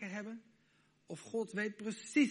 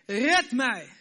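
loud, high desperate cry for help; crying out to God; 5 semitones higher, 11 dB louder, much slower than usual. Retold or imitated. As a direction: shout it like a drowning man. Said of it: "die het uitschreeuwt naar God".

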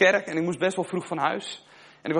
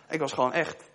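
usual speaking voice

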